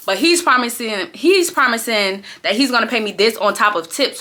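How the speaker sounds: usual speaking voice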